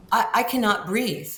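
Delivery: ordinary delivery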